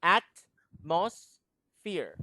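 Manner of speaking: Atmosphere drawn out very slowly, with a clean AT and no S; slow careful pronunciation. Shown as a demonstration. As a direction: sound out Atmosphere slowly, syllable by syllable. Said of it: "At doesn't have an S".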